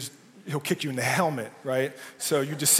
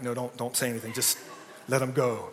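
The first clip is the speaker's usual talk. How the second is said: soft, laughing hush to a teammate; quietly urging silence through a laugh; hushed warning; 4 semitones lower, 6 dB quieter than usual. Retold or imitated. Player hushing a teammate at the pep talk, soft and laughing. Whispering to teammates not to speak.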